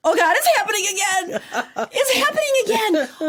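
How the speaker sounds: high, loud panicked wail with a laugh; mock panic. Retelling the edible panic at dinner, high loud laughing wail. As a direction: wail it like doom has come.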